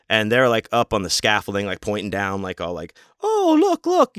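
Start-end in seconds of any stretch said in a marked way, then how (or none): 3.2-4.2 put-on very high squeaky kid's "oh look"; mock kid voice. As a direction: squeal it like an excited child. Kids on scaffolding pointing down.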